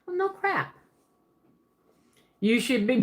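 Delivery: ordinary talk, nothing marked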